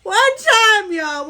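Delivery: whiny laughing protest; laughing whine. Real, not acted. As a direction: crack up pleading one time. Laughing protest, near crying.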